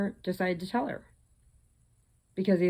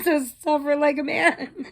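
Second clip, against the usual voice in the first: laughing taunt; 10 semitones higher, 5 dB louder than usual. Retold or imitated. Final taunt to the workers.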